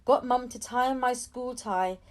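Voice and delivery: ordinary delivery, nothing marked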